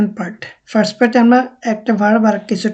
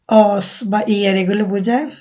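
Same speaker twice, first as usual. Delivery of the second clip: normal talking voice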